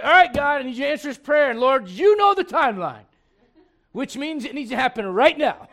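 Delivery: loud, high, hammed-up pleading; mock demanding prayer. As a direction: belt out an impatient prayer in mock urgency. Mimics impatient demanding prayer to God.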